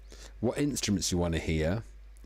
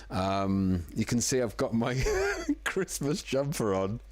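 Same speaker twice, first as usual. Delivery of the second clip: ordinary talk, nothing marked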